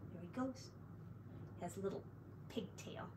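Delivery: soft, near-whispered aside; hushed aside. A narrator's reading. Narrator confiding about the piglet in a hushed aside. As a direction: lean in and murmur it to the listener.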